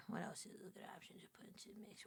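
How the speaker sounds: fast, breathy whisper to herself. Shown as a demonstration. Streamer whispering her game question to herself. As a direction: whisper the question quickly, almost secretly.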